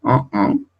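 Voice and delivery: en said as nasal ɑ̃. Shown as a demonstration. Teacher to class, isolating nasal en. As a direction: keep en in the nose. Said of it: "se pronuncian igual".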